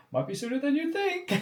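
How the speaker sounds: squeaky quoted voice. Retold or imitated. Host quoting Nick's teasing comment, high mock voice. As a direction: tease it in a high put-on voice. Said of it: "was like".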